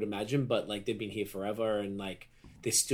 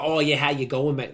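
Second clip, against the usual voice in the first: a strong Aussie twang, louder and higher; broad Australian; 3 semitones higher, 6 dB louder than usual. Retold or imitated. Showing how Aussie he never talked, in broad Australian. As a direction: lay on a broad Australian accent. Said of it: "I also never spoke like that".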